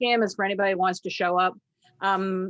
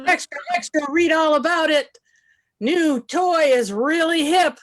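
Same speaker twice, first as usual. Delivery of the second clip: Cockney newsie; 7 semitones higher, 10 dB louder than usual. Acted. Last player giving the hip pun, high loud newsie call. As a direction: sing out the headline to sell papers. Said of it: "headlines in a Cockney accent".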